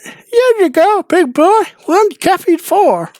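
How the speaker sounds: very high, slow falsetto waitress; high falsetto waitress serving coffee to the officer; Carol the waitress. Retold or imitated. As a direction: coo it in a high put-on lady voice. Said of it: "She sets your coffee down and says".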